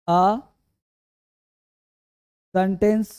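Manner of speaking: long pauses between single words; word-by-word dictation. Real, not acted. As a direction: dictate slowly for students writing it down. Dictating a definition while writing it. (एक-एक शब्द रुक-रुककर लिखवाते हुए)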